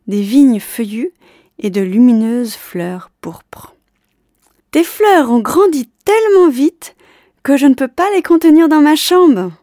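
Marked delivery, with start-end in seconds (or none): 4.7-9.5 high, loud joyful yell; boy yelling his happy news below; excited shout. Acted. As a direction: call out thrilled from a window. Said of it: "lui hurla-t-il".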